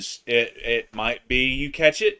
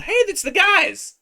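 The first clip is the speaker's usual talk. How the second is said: surprised; 5 dB louder than usual. Pretend. Imitating a scrolling viewer.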